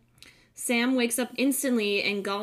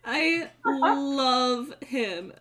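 laughing cry; 6 semitones higher, slower than usual. Real, not acted. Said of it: "It makes me so sad".

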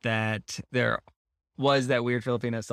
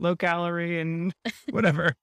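put-on higher, half-hearted French accent; mock French accent; 4 semitones higher than usual. Prisoner excusing himself over his diet, in a mock French accent. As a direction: give up halfway through a fake French accent. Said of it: "he would say in a French accent".

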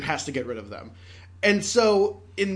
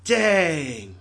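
dramatic Dang; 4 dB louder than usual. Pretend. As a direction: let Dang sink like a loss. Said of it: "I will now do a dramatic monologue".

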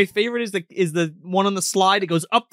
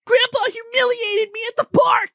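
put-on high complaining kid voice; whiny kid; 14 semitones higher, 3 dB louder than usual. Son complains to mom about grandpa, high whine. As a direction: whine it to mom like a sulking boy. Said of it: "door gets kicked in".

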